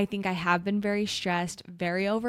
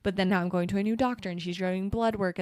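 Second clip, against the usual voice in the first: ordinary talk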